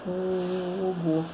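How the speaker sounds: soft, long-held "Fo" in Fogo; cheering in your head. Shown as a demonstration. Teacher shows silent cheering for exams, Fogo softly drawn out. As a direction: chant Fogo quietly, stretching the first syllable. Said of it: "Torce pela palavra na sua mente".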